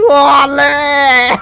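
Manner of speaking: high, loud olé shout; triumphant shout. Real, not acted. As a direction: shout it to taunt a friend. Taunting after escape.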